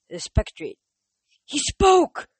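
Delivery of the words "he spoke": high, loud frantic cry. Acted. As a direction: blurt it out in a panic. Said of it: "Grover cried".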